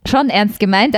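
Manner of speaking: ordinary delivery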